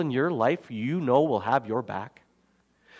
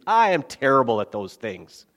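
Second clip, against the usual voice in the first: ordinary delivery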